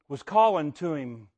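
usual speaking voice

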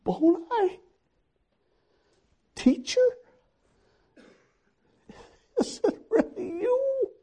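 high, breathless disbelief; Mary stunned to see him; astonished recognition. Acted.

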